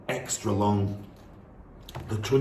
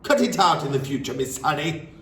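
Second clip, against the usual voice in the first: headmistress scolding; 6 semitones higher than usual. Acted. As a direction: tear a strip off the teacher. Trunchbull scolds the teacher.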